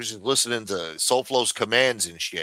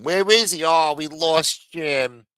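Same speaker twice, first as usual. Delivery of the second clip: mock host; 5 semitones higher, 6 dB louder, slower than usual. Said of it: "She's clicking".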